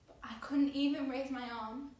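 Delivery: loud, strained surge of panic; desperate. Acted. Girl trapped in the crush, desperate. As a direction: burst out, pinned and helpless.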